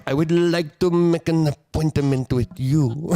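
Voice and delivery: put-on slow French-accented English, laughing; mock French accent. Host imitating French-accented English, slow and laughing. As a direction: purr it like a charming Frenchman, slowly. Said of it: "a simple thing like".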